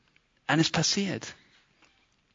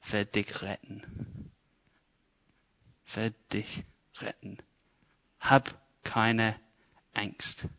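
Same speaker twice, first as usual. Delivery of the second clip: soft, slow, hushed promise; calm the frightened crowd quietly; calm reassurance; 4 semitones lower, 10 dB quieter, slower than usual. Retold or imitated. Moses reassures the people.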